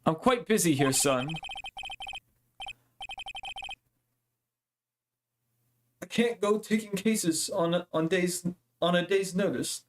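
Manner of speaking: a hint of a haughty old lawyer; lightly voiced dismissive lawyer; haughty lawyer brushing off a case; pompous lawyer voice. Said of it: "now I gotta give him a voice".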